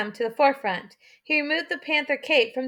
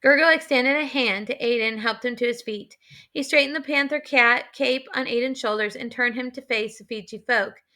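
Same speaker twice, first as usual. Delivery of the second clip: ordinary delivery, nothing marked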